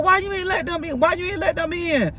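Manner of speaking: high-pitched mock questioning; pressing retold demand. Friend asking why guests weren't let in, high and pressing. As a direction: repeat it high, pressing for an answer.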